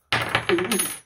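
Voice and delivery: high nervous giggle bursting out; nervous laugh. Real, not acted. Admitting nerves to viewers, nervous laugh.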